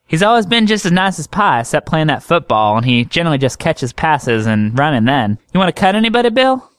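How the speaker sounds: high, chatty country doctor voice; high drawling woman doctor teasing a patient; folksy woman doctor. Acted.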